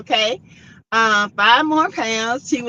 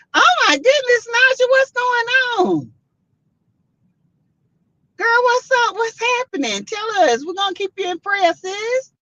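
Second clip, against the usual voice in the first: much higher, urgent and caring; concerned surprise; 13 semitones higher than usual. Real, not acted. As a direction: reach out to a sick friend. Viewer says she is in hospital.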